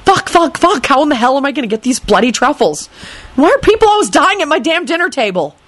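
high, agitated cursing; exasperated. A narrator's reading. Host frets over truffles and corpse, exasperated.

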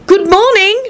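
high greeting called across the room. A narrator's reading. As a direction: call good morning toward the bed. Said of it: "She called out".

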